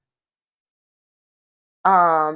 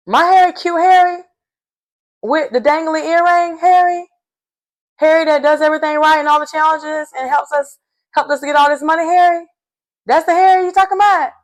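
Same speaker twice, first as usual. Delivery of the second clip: high, squealing disbelief, each Harry pitched higher; mock outrage; 9 semitones higher, faster than usual. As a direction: gush over cute Harry in shocked defense. [name] defends Harry in disbelief.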